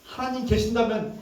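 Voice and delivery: acted indignant protest, opening high; indignant complaint. Psalmist protesting to God about the wicked prospering, angry retold complaint. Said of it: "막 화가 나요".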